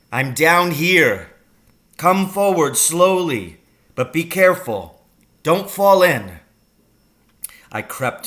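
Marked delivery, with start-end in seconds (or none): 0.1-6.4 loud, raised, slow warning; calling up. A narrator's reading. Father guides his son from the pit, calling up. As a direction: call slow, careful directions from below.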